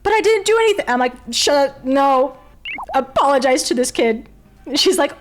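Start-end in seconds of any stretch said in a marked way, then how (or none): 0.0-0.9 high, loud whine of protest, acted out; hammed-up high-pitched objection; mock indignant protest. 1.3-4.3 loud, high, insistent order; forceful telling-off, retold; retold scolding. Acted scolding back at mother.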